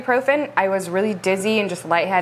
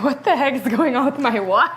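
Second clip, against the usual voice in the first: imitating husband; 4 semitones higher than usual. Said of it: "him just being like".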